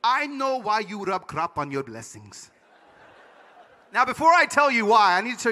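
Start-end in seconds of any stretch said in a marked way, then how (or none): 0.0-2.5 put-on slow, weighty Panamanian accent; Panamanian father. Panamanian immigrant dad answering his son, slow accented voice. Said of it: "so my dad said".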